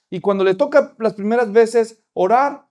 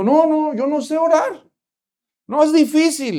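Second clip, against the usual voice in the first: put-on much higher child voice protesting; little girl; 8 semitones higher than usual. Little girl tells the pastor she can't pray, high child voice. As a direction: squeak out a scared refusal.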